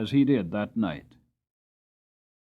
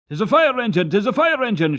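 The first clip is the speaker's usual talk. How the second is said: higher and louder excited cry; shouting; 9 semitones higher, 7 dB louder than usual. A narrator's reading. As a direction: announce the engine to the crowd. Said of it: "shouted two or three voices".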